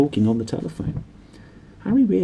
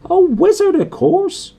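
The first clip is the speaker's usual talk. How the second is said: Hagrid voice; 7 dB louder, slower than usual. A narrator's reading.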